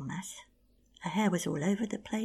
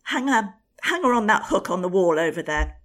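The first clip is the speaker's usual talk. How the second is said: furious order; 3 semitones higher, 16 dB louder than usual. Acted. Queen ordering guards.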